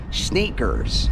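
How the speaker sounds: a deliberately bad American accent on sneakers; mock American accent. Shown as a demonstration. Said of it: "It's a terrible accent".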